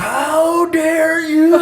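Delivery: mock outrage. Neighbor answers the cop jab in hammed-up outrage. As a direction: ham up a scandalized how-dare-you. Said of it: "He's like".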